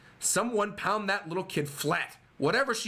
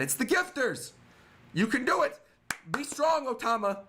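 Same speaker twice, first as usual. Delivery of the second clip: mock scream; 6 semitones higher than usual. Gifters screaming support up at Otama. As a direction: yell it like a crowd cheering her on. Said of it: "they're screaming again".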